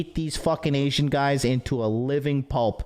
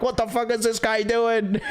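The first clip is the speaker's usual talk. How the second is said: higher, laughing mock outrage from the staff; baffled karaoke staff; 6 semitones higher than usual. Karaoke staff reacting to a drunk peeing off the balcony, laughing in disbelief. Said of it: "the karaoke people were like".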